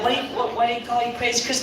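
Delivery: high, soft asking friend; curious friends. Retold or imitated. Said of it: "everybody's like".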